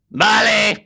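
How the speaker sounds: put-on "Vale" high and loud in a Spanish accent. Imitating the Spanish bar staff at the door, high and loud. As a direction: chirp a bright Spanish okay. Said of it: "And they go".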